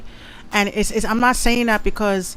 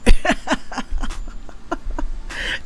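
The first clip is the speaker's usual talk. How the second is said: high breathy giggle; 8 semitones higher than usual. Real, not acted. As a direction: laugh it off, high and light. Laugh after joke.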